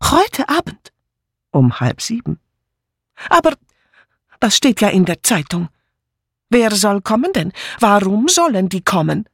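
high-pitched, agitated questioning; high voice rising in protest; agitated maid. Acted. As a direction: fire off indignant, disbelieving questions.

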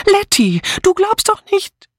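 very high, aghast protest; horrified high-pitched appeal. A narrator's reading. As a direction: cry out in alarmed disbelief. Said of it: "rief Dora entsetzt".

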